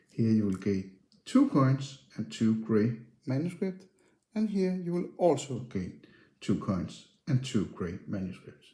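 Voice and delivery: normal talking voice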